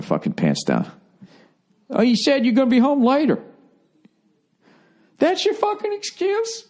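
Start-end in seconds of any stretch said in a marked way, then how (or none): 1.9-6.7 high falsetto mock quarrel; mock nagging partner. Partner challenging the other over an excuse, high mock indignation. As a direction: argue in a squeaky, much higher put-on voice.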